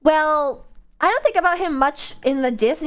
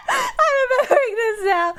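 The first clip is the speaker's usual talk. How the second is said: high-pitched wail of laughter on the words; laughing hard; 12 semitones higher than usual. Real, not acted. Streamer remembering childhood crying with sister, laughing helplessly. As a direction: squeal it out through helpless laughter.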